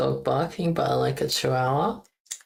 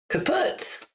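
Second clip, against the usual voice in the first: mock dismay; 13 semitones higher than usual. Exclaiming at game failure.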